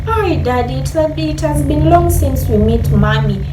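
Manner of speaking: ordinary delivery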